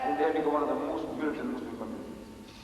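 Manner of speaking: normal talking voice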